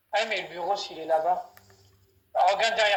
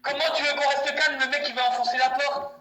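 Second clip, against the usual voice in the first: high, agitated plea; pleading and scared; panicked caller; 8 semitones higher, 6 dB louder than usual. Acted.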